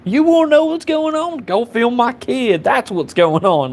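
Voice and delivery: high-pitched irate retort, easing down at the end. Retold or imitated. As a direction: imitate an irate father in a high voice. Acted hostile reply to concerned bystander.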